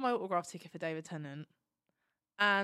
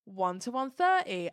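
drawn-out imitated announcement, slightly raised; calling out tickets; 3 semitones higher, 4 dB louder than usual. Steward calling numbers as fan waits, imitated drawn-out call-out. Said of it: "they start calling out autograph tickets".

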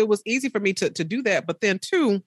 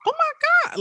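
squealed amazed "Oh my God"; stunned. Retold or imitated. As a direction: shriek of astonished disbelief. Reacting to six-hour drive.

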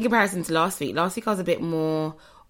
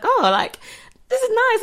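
high, louder imitation of pleased surprise; upbeat, high retold reaction; retold delight; 4 dB louder than usual. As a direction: act out delight at good news. Acts out reaction to good news.

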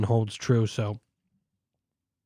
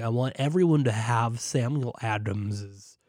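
slow, botched Boston accent imitation; mock the Sam Adams guy's Boston accent badly; a hint of a Boston ad voice; 3 semitones lower, 3 dB quieter, slower than usual. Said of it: "That's not even his accent".

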